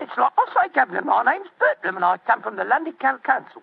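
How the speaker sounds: put-upon Cockney workman; Cockney accent. Acted. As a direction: insist on your cover story in Cockney. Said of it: "My Cockney accent".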